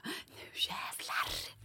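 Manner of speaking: hushed voiceless vow of determination; whispered vow. Retold or imitated. Malin before the test, whispering her determined vow. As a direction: whisper it like a secret battle cry. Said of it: "Så jag bara".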